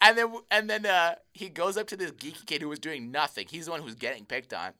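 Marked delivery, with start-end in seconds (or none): none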